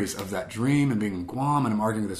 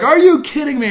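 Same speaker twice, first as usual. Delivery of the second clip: much higher, louder shocked yell; freaked out; 12 dB louder than usual. Retold or imitated. Reliving the shock in the woods, high shocked yell. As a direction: yell it in disbelief at the sky. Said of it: "And I freaked out".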